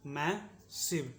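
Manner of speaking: "Massive" very slowly, a long gap after मै; slow syllable demonstration. Shown as a demonstration. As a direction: split "massive" into मै, pause, सिव. शब्द तोड़कर दोहराना. ("Massive" को बहुत धीरे, मै और सिव अलग करके)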